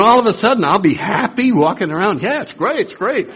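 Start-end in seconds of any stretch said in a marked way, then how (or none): 2.2-3.3 much higher sing-song happiness; mock cheery. Ego pretending to be happy, high mock cheer. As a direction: chirp it in a fake bright tone.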